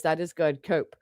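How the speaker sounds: low, soft, smug taunt; quiet smirking put-down; mocking dismissive. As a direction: brush her off with a sneer. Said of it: "All I gotta say is".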